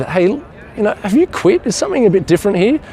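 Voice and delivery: high, lightly voiced surprised question; curious surprise.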